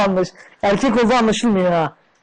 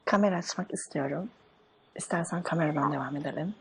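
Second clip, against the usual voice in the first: soft, quiet put-on woman's voice; man playing a woman softly on the phone; man doing a woman's voice; 3 semitones lower, 13 dB quieter than usual. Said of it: "Oğlum ben kadın sesi yapamam ki".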